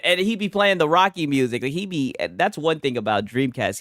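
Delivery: normal talking voice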